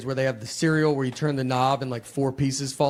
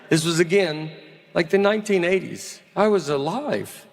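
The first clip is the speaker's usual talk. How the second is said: ordinary talk, nothing marked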